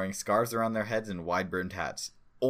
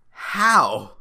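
exasperated creature voice; 11 semitones higher, 13 dB louder than usual. Acted.

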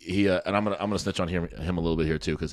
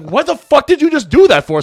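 high, loud, drawn-out outraged demand; retold angry outburst; 13 dB louder than usual. Friend demanding an explanation for the sudden wham, loud indignant disbelief. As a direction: imitate an outraged friend, pitched way up.

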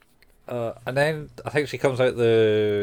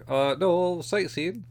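higher-pitched voice for a retold answer; lightly voiced innocent reply; 8 semitones higher, slower than usual. Jesus answering the landlady late at night, lightly voiced. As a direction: imitate a sheepish excuse, pitched up.

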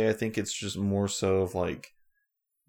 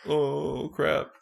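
higher, drawn-out "uh-oh" voice; mock dismay; 8 semitones higher than usual. Acting out Mike frozen in midair, mock dismay. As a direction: play someone stuck and dismayed.

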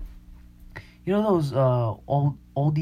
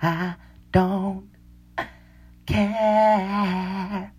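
mock drawn-out sneer; 8 semitones higher, much slower than usual. Mocking Instagram drinkers.

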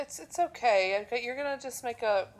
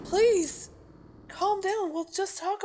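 urgent plea; 7 semitones higher, 6 dB louder, slower than usual. Acted. Calming escalating partner.

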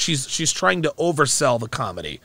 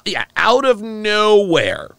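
slow, higher sarcastic mimic; mocking echo; 6 semitones higher, slower than usual. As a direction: drawl her phrase back to mock it. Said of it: "This is, uh".

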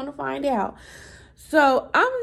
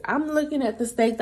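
ordinary delivery

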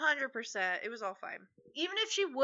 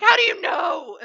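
mock panicked challenge; 6 semitones higher, 7 dB louder than usual. Retold panicked challenge to partner.

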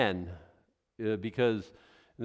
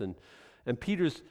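ordinary talk

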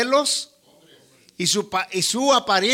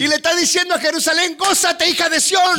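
rising preaching; 5 semitones higher than usual. Acted. Preaching a prophetic proclamation.

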